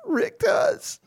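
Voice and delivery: high, tearful wail; crying. Acted. Kale, crying, blames Rick to his face. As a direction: blurt it out through sobs.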